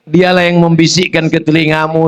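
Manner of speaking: usual speaking voice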